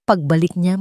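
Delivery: ordinary delivery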